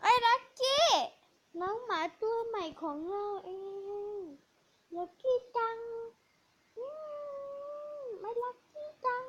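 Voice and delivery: high cooing pet voice. Real, not acted. Cooing to new puppy. (เสียงสูงพูดกับน้องหมาตัวใหม่)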